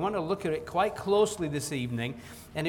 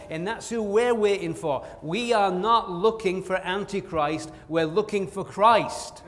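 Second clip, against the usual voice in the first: preaching with fervor; 3 semitones higher than usual. Acted. Preaching rises to full force.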